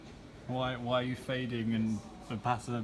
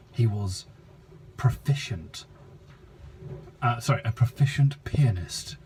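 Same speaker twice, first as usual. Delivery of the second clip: narrator voice; 5 dB louder than usual. Acted. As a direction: read it like a grand nature documentary. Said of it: "Are you using your narrator voice?".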